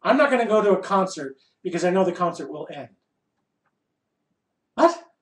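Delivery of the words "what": squeaky high disbelieving what; incredulous what. Acted. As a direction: yelp it in disbelief. Mock disbelief.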